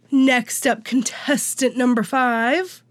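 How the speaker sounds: ordinary talk, nothing marked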